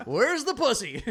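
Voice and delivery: high, loud parody catchphrase; mock comic bravado. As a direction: throw it to the crowd through laughter. Mock comic's catchphrase, amid laughter.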